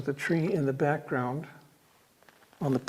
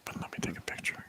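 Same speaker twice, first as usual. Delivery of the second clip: soft, hushed whisper; 15 dB quieter, much faster than usual. Real, not acted. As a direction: whisper it under the breath. Still whispering in museum, taking photos.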